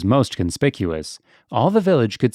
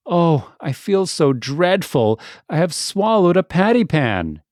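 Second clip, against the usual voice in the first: moaning and whining; 5 semitones higher than usual. A narrator's reading. Duchess complaining to Ribby, moaning and whining. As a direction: moan it as if truly ill. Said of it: "Duchess moaned and whined".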